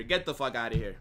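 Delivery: ordinary delivery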